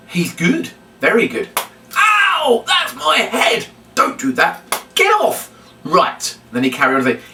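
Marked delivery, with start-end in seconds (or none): 2.0-5.5 mock ouch. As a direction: overplay the pain for the children. Puppet whacks presenter on head.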